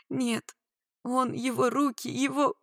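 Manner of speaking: high, broken, halting phrases; distraught. A narrator's reading. Woman in rescuer's arms, distraught. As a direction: struggle to get each word out. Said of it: "Я даже не могла выговорить слова".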